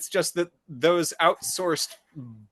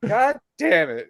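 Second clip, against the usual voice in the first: high, slow exasperation; fed up groan; frustrated; 10 semitones higher, slower than usual. Retold or imitated.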